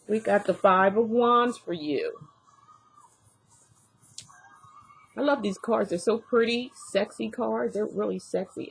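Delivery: talking around a new retainer; slightly slurred with a retainer in; wearing a retainer. Real, not acted. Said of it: "Excuse my little lips in my, uh, language".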